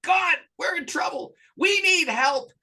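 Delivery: high, loud, pleading cry to God, slowing at the end; desperate prayer. Retold or imitated. Couple praying after a fight, desperately pleading. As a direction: beg God for help as if the house were on fire. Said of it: "And they said".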